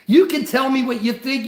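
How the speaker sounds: normal talking voice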